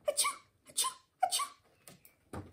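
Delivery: big escalating sneezes; series of sneezes. A narrator's reading. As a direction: sneeze harder each time. Cat sneezing fit.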